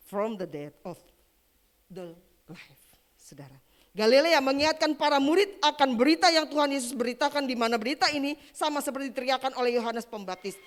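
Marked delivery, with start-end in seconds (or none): 3.9-10.6 loud, driving proclamation; full-force preaching. Real, not acted. Preacher recalling Galilee to the congregation, full-force preaching. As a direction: drive the message home at high volume.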